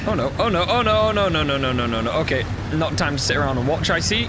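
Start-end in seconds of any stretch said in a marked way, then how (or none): 0.1-2.1 panicked, building up; rising panic. Real, not acted. Panic while drowning in game.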